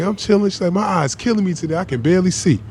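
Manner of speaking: high grandma voice complaining of her eye; imitating grandma. Grandma tells her grandson her eye hurts, high voice. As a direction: voice an old lady in pain.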